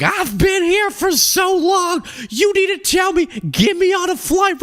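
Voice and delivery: high, slow mock shriek of a fed-up traveler; mock angry passenger. Angry traveler berating airline staff, high mocking shriek. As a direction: ham up the entitled customer yelling at the desk. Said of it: "she was like".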